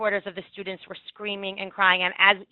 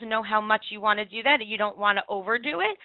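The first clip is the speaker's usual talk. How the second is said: normal talking voice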